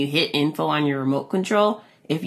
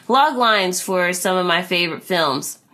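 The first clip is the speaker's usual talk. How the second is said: usual speaking voice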